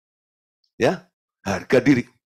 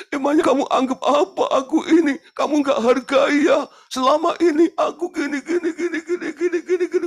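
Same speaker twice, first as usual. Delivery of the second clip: high, loud hammed-up wailing complaint, breaking into laughter; mock wailing wife; 13 semitones higher, 4 dB louder than usual. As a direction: overdo a weeping wife's protest. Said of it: "saya nggak bisa niruin meweknya ibu-ibu". (istri merengek dibuat-buat, tinggi dan keras)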